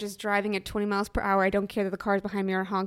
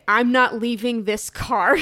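stubborn; 3 semitones higher, 7 dB louder, slower than usual. Retold or imitated. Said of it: "And you say".

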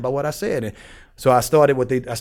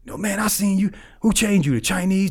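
higher-pitched imitation of a pushy inmate; a hint of an eager inmate, pitched up; insistent inmate; 7 semitones higher than usual. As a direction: lightly mimic an excited questioner.

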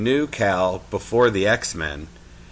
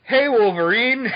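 high, loud, goofy call-out; idiot fan greeting; 14 semitones higher, 7 dB louder than usual. Retold or imitated. Host mocking how he'd greet his guest, high goofy shout. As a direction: blurt it like a starstruck dork. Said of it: "I'm gonna sound like an idiot".

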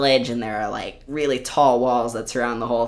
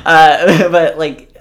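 laughing; 5 semitones higher, 14 dB louder, much slower than usual. Real, not acted. Laughing at own obsession.

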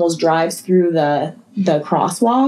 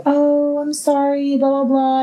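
mock apology; 7 semitones higher than usual. Neighbor's fake apology, mocked.

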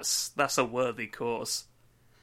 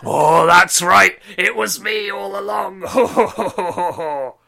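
villain reveal; 6 semitones higher, 13 dB louder than usual. Acted. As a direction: gloat like a cartoon villain. Villain reveal, mock laugh.

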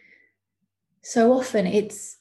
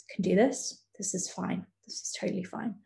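hushed, softer self-reassurance, fading to a whisper; whispered inner voice; 7 dB quieter than usual. Retold or imitated. Sitting in the eating disorder workshop, telling herself it's fine in a whisper. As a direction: whisper it to yourself to keep calm.

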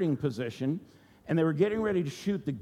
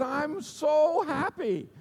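much higher and slow, beaming; high, drawn-out joy; overjoyed swimmer; 11 semitones higher, 3 dB louder, much slower than usual. Retold or imitated. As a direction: stretch it out, giddy with happiness. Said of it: "and he said".

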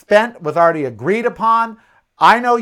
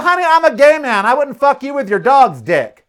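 higher and louder, cutting retort; sassy comeback; 7 semitones higher, 4 dB louder than usual. Retold or imitated. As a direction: throw the zinger back with sass. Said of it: "I look at her and I go".

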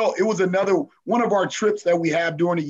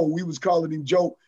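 ordinary delivery

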